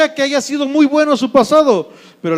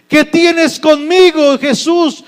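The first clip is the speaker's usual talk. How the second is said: raised, high, slow cry; loud exclamation; 6 semitones higher, 5 dB louder, slower than usual. Acted. Possessed man confronting Jesus, exclaiming loudly. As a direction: cry it out slowly in a great voice. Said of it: "exclamó a gran voz".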